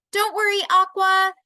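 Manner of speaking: loud, high reassuring call; boy calling out to the captured mermaid. Acted. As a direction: shout comfort across to her. Peter calls to Aqua.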